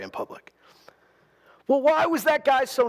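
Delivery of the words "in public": drops to a whisper on the last demand; hushed, pointed whisper. Retold or imitated. Paul to the officials, demanding the apology in a whisper.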